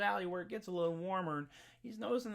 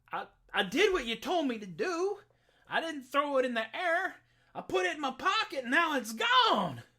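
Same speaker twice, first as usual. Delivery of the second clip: put-on higher, louder, flustered country boy; simple Jack; 8 semitones higher, 6 dB louder than usual. Jack explaining to the sheriff's daughter, flustered and higher. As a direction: protest it like a guileless boy caught out. Jack's voice in told tale.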